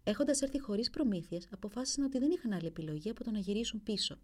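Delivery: ordinary delivery, nothing marked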